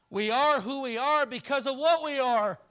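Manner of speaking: booming, high and loud declaration; preaching shout. Real, not acted. Preacher driving home the point.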